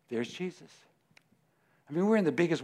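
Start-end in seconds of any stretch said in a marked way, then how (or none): 0.1-0.7 much softer, like spotting Waldo; hushed pretend spotting. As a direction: softly point him out.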